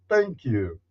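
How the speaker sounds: usual speaking voice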